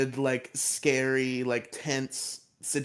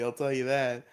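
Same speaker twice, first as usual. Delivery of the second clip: normal talking voice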